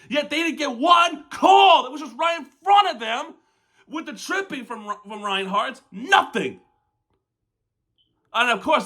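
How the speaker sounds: high-pitched, heated rant; real frustrated outburst, pitch up throughout; freak out mode. Real, not acted. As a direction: vent hard at the critics. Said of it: "that is a freak out mode from me".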